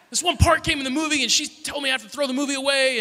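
high-pitched, agitated complaint; furious. Retold or imitated. Little brother reporting mum's punishment to older brother, imitated furious ranting. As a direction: rant like a kid brother who got in trouble. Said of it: "furious and angry".

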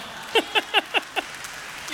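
high, breathy run of chuckles; real chuckle. Real, not acted. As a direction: laugh at your own joke. Laughs at his own joke.

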